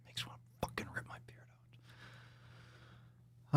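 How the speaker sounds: fast, barely audible whisper; exasperated whisper. Real, not acted. Podcaster exasperated by the tweet, rapid faint whisper. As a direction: whisper it rapidly under your breath.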